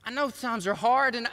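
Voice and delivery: normal talking voice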